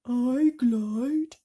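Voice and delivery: a prissy little correction; primly. Acted. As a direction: earthworm setting him straight primly. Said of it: "said the earthworm primly".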